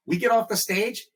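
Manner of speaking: usual speaking voice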